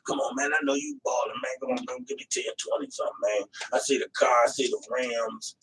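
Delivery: very soft, mumbled street hustle; begging hype. Acted. Imitated addict begging a stranger for money, hushed hustle. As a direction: wheedle for cash under the breath. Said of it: "You sound like a hype".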